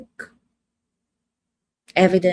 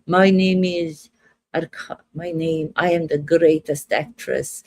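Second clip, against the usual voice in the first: grand, lower Russian accent; 3 semitones lower than usual. Shown as a demonstration. Actress boasting of her greatness, theatrical Russian accent. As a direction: put on an in-between Russian accent. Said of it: "Doesn't even sound like Russian".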